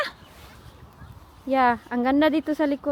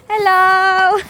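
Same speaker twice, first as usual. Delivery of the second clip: loud, higher, long-held hello; called-out greeting; 5 semitones higher, 9 dB louder than usual. Real, not acted. Parent by the water greeting a friend, calling out. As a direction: holler a greeting out across the distance.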